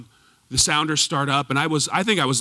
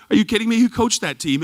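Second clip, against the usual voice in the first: louder, higher incredulous challenge; mock disbelief; 6 semitones higher, 4 dB louder than usual. Mark scoffing at the coach choice, raised incredulous voice. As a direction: throw the question back in disbelief. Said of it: "he goes".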